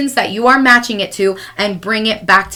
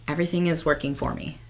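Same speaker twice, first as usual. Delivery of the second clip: soft affirmation; 4 semitones lower, 6 dB quieter than usual. Pretend. Self-talk mantra in hard moments.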